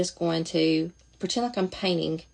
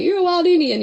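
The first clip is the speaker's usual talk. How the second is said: mimicking mom, much higher and louder; imitating mama; 12 semitones higher, 6 dB louder than usual. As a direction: do mama's scolding sing-song, pitched way up. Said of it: "like my mama would say".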